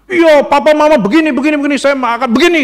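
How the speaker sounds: loud, high, rapid mock complaint; angry child. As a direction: act out a kid griping about the parents. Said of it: "satu anak yang marah-marah". (tiruan anak marah-marah, nada tinggi)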